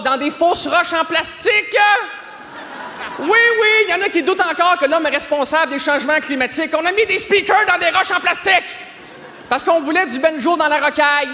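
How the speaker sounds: high-pitched, building comic rant; mock fanatic zeal. Comic demanding speakers in plastic rocks, mock frenzied rant.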